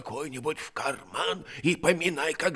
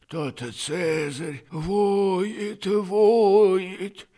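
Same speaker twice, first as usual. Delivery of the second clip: rising lament; 11 semitones higher, 10 dB louder, much slower than usual. Acted. Old man telling of howling dog.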